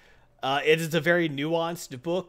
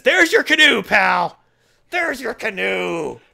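much higher, much louder triumphant yell, slowing down at the end; defiant yell; 7 semitones higher, 10 dB louder, slower than usual. Retold or imitated. American stabbing himself at the cannibals, furious triumphant yell. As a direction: scream it in the cannibals' faces. Batman's yelling.

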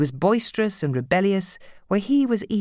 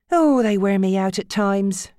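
moaning; 6 semitones higher, 5 dB louder than usual. Acted. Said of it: "she moaned".

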